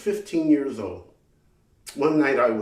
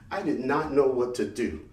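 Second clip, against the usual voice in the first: ordinary talk, nothing marked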